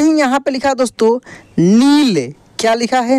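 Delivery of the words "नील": नील with the ī stretched, rising then falling; drawn-out long ī. Shown as a demonstration. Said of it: "इसका उच्चारण खींच कर होता है". (नील की ई खींच कर)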